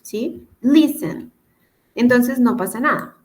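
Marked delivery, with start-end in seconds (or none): 0.6-1.3 listen with a high, punched first syllable; stress on first syllable. Shown as a demonstration. English teacher shows where the accent sits, listen stressed at the start. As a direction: hit LIS high, then let -en fall. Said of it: "la acentuación la tiene al, al principio".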